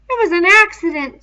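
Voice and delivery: high, loud sudden blurt; Piggy blurting. A narrator's reading. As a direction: blurt it out before you think. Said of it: "said Piggy suddenly".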